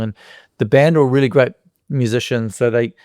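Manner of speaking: ordinary talk, nothing marked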